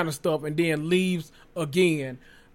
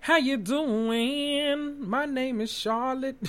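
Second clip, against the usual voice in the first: high, soft, slow mock greeting; put-on high voice; 9 semitones higher, 3 dB quieter, much slower than usual. Clowning a fake intro to viewers, high soft put-on voice. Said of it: "Now, let me stop".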